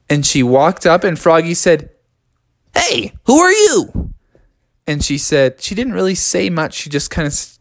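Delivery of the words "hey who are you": high, drawn-out challenge; curious frog. Acted. Froggy meeting a tiny winged stranger, high slow questioning call. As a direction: call out slowly in a raised voice.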